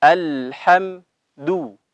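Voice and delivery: slow Alhamdu with rounded lips on dal; Quranic recitation. Shown as a demonstration. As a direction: stretch out Alhamdu, lips pushed forward. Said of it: "Kita baca". (bacaan pelan dengan bibir dimonyongkan)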